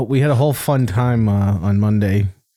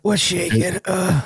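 mocking stuffy voice; 6 semitones higher, slower than usual. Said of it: "peanut butter stuck to the roof of your mouth".

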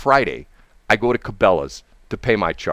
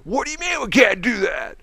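retold yelling; 11 semitones higher than usual. Customer refused by the clerk, mock yelling in outrage. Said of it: "he starts yelling at her".

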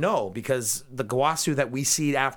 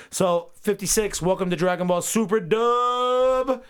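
slow, higher, louder announcer intro; mock show intro; 7 semitones higher, 4 dB louder than usual. Host mock-announcing the podcast episode, announcer voice. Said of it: "is that how we end the show now".

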